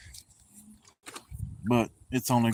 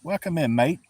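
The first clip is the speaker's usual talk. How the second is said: higher-pitched Australian attempt, rising on "mate"; botched Australian accent; 5 semitones higher than usual. Shown as a demonstration. Streamer greets an Australian viewer, botched Australian accent. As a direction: have a clumsy go at an Australian accent. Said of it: "I chopped the hell out of that".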